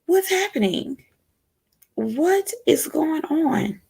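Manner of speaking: high, soft and slow, baffled; a lightly voiced baffled inner voice, drawn out; bewildered inner thoughts. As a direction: ask it like you truly can't believe it. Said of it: "am I the only person that is really thinking".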